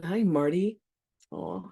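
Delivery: normal talking voice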